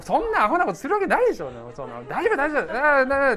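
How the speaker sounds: high, quick, overconfident brush-off; mock dismissive. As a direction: play the cocky husband shrugging off a warning. Retold dismissal of wife's warning. (自信満々に軽くあしらう口調で)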